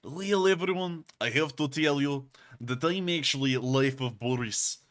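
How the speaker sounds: put-on Russian accent in the style of a YouTuber; hammy, imperfect Russian accent; terrible Russian accent. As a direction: do a badly faked Russian accent. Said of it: "I'm doing a terrible one now".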